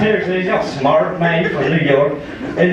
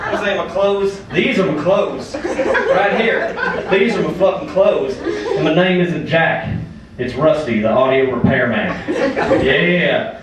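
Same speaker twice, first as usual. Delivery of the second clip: put-on Rusty, cocky Southern good old boy. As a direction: play a rowdy Southern comic working the crowd. Plays Rusty the Southern comic.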